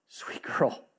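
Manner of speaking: softly whispered endearment; tender whisper. Retold or imitated. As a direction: breathe it to her gently. Father whispers to weeping daughter.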